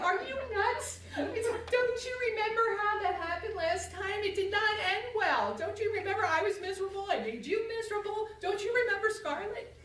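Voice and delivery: high, loud incredulous pleading; exasperated protest. Retold or imitated. Wife protests to husband on the couch, high and exasperated. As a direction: pitch it high in disbelief.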